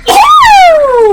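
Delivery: disgusted shriek. Real, not acted. Disgusted reaction to yelling.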